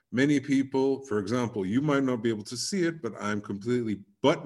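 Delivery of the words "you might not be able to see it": normal talking voice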